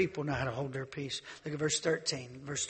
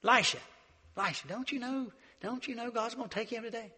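higher, taunting call; mocking preacher boys; 6 semitones higher than usual. Preacher boys taunting Elisha, high mocking call. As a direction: taunt him from across the road. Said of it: "they said".

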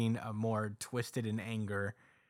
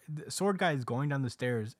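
ordinary delivery

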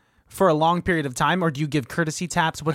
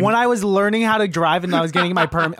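ordinary talk, nothing marked

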